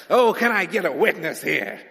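loud call for a witness; hammed-up pulpit rally cry; mock hype preacher. Preacher rousing the congregation for an amen, loud and hammed-up.

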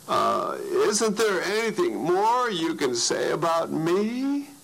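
high, timid little plea; shy asking. Retold or imitated. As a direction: ask it hesitantly, like a shy boy. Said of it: "very shyly".

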